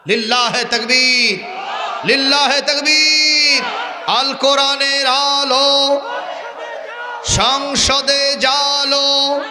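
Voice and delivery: high, drawn-out rallying cry; slogan calling. Real, not acted. Preacher leading a crowd in takbir, slogan calling. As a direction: call it out long for the crowd to answer.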